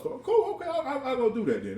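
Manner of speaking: mock eager reply. Retold eager reply to the lady.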